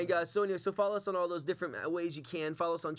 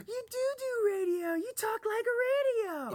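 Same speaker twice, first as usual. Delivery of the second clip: very high, slow gasping amazement; amazed shriek; 3 dB louder than usual. Retold or imitated. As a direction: shriek it in stunned disbelief.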